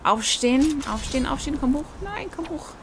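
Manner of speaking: high, rising frantic urging; urgent pleading. Real, not acted. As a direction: beg the character to get up. Urging fallen character up.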